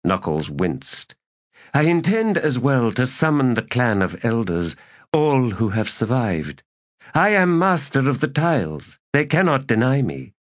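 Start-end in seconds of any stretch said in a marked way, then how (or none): 1.7-10.3 a lightly voiced errant, higher and insistent; scheming elder god. A narrator's reading. Errant to Knuckles, lightly voiced and insistent. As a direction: coax an old ally back to power.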